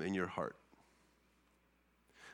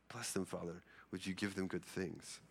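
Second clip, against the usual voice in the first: hushed prayer; 8 dB quieter than usual. Shown as a demonstration. Example blessing prayer, hushed.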